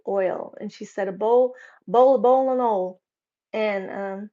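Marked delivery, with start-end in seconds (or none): 1.1-3.0 "boiling" run together into "bowl, and"; Southern pronunciation. Retold or imitated. Wife answering her husband, Southern slurring of "bowl, and oil". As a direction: say "boiling oil" like "bowlin' ol'". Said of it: "like you normally say it".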